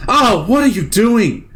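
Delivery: high, loud cry at the game; exasperated yell. Real, not acted. Gamer yelling at the game, high and loud. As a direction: scold the game.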